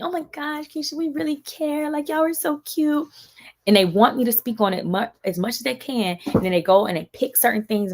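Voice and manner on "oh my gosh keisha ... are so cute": fake sweet gush. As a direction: gush like a phony fan. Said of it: "they try to dress it up to me".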